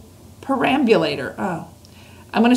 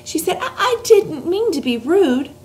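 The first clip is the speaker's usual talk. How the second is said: put-on much higher, breathless apologetic girl; upset girl; 12 semitones higher than usual. A narrator's reading. Sophie apologizing to the giant, upset and high. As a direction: apologize in a rush of dismay. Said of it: "Sophie got quite upset".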